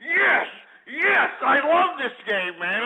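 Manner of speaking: high, drawn-out exultant cries; gloating triumph. Real, not acted. Host celebrating his favorite game, gloating and elated. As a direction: crow each word in triumph.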